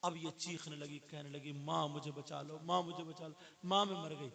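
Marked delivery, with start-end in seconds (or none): 1.5-4.3 cry for help. Retold or imitated. Girl screaming for mother.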